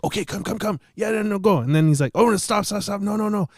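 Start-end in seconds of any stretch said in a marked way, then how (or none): 0.0-1.6 high, frantic mock stage directions; acted-out jittery lookout waving him on; urgent beckoning. Acting guy beckoning while sneaking. 2.2-3.5 high, urgent mock panic; acted-out alarm, pitched way up; frantic halting. Acting guy frantically halting him.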